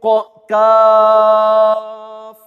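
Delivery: काफ drawn out very slowly and loud; long qaaf. Shown as a demonstration. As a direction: stretch काफ five alifs. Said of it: "इसको हम पांच अलिफ खींचेंगे". (काफ पांच अलिफ खींचकर)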